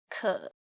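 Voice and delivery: "ke" low, staying down; third tone. Shown as a demonstration. Teacher showing the difference, "ke" kept low. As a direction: drop "ke" to the bottom of your pitch. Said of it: "read it with four different tones".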